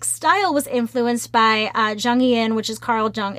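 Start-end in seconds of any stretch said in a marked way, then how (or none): none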